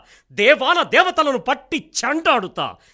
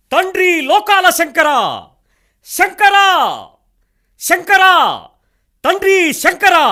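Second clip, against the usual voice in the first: much higher, loud, slow ringing invocation; calling on Shiva; 7 semitones higher, 6 dB louder, slower than usual. Acted. King Mallanna calling out to Lord Shankara, loud ringing invocation. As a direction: call out to the heavens for Shankara.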